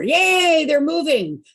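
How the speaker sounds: high, loud happy cheer. Real, not acted. Host to group as screen unfreezes, cheering. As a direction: cheer with a drawn-out rise.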